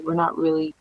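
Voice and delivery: ordinary talk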